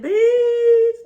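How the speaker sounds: high, very loud drawn-out yell; hammed-up screeching comeback; retold outcry. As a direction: squeal it back at a friend in mock outrage. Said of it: "I said".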